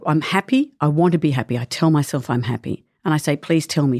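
ordinary delivery

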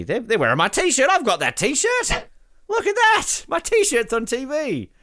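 high-pitched, giddy excitement; thrilled. Real, not acted. Host spotting his own T-shirt on TV, thrilled. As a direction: climb into a delighted high squeal.

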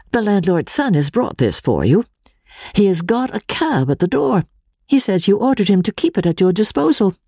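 lightly voiced Marian. Marian telling Walter the cab is waiting, lightly voiced. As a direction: report it plainly as the sister.

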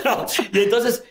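ordinary talk

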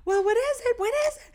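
high, loud, eager questioning; excited mom. Retold or imitated. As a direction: squeal it impatiently, imitating an excited mom. Mom eagerly asking about the baby.

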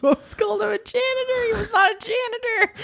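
high, squealing through laughter; laughing protest. Real, not acted. Wife teasing husband over his delivery-room story, laughing hard. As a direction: crack up while correcting him, voice way up high.

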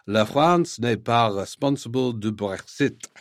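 clumsy French in a British speaker's put-on accent; terrible French accent. Quoting the French transport minister, in a terrible French accent. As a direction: have a brave, bad go at a French accent. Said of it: "that terrible French accent".

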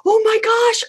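high-pitched acted gasp of wonder; amazed realization. Coach voicing a parent's aha moment, high amazed realization. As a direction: pitch jumps up as the insight lands.

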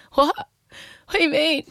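shocked, drawn-out question; alarmed. Retold or imitated. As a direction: recoil in disbelief. Panicked reaction to forum dissection.

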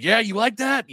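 hammed-up, cheeky come-on; playfully taunting trolls, high and loud; teasing taunt.

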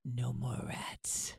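slow, whispered punchline; hushed payoff. Real, not acted. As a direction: let it out in a drawn-out whisper. Punchline of her rat remedy story.

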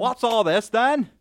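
hammed-up British bobby asking what's going on; bad British accent. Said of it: "I can't do a British accent".

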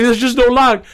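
high-pitched mock panic; panicked protest. Kid in the stall to his dad, mock panicked. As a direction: imitate a flustered kid pleading his excuse.

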